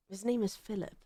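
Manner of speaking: softly trying out the name "Philip"; quiet musing. Real, not acted. Deciding the roommate's name, quietly trying it out. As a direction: murmur "His name is Philip" to yourself. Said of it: "naming the character".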